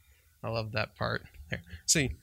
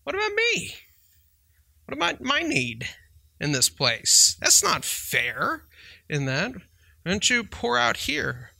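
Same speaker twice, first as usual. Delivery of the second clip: much higher, complaining "What about me?" as hurt followers; whiny disciples; 8 semitones higher than usual. Retold or imitated. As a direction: mimic the crowd protesting it isn't fair. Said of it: "The guys are like".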